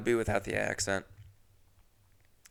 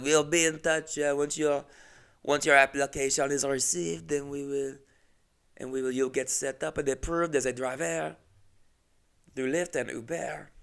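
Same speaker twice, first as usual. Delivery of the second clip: put-on French accent; 3 semitones higher than usual. French company man signing off an interview, mock French accent. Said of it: "Just a normal French guy".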